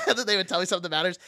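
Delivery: ordinary talk, nothing marked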